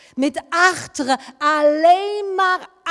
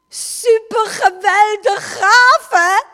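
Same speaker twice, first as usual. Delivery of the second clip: high, loud, slow gushing delight; thrilled exclamation; 10 semitones higher, 10 dB louder, slower than usual. Retold or imitated. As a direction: beam it out like a delighted kid. Nick excited about the healing.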